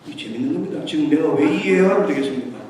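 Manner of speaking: normal talking voice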